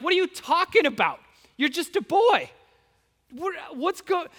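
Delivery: high, quick acted disbelief; incredulous. King Saul dismissing the boy who offers to fight, incredulous.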